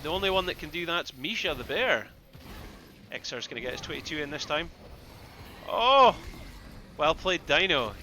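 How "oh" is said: surprised oh. Real, not acted. Big play surprises the caster.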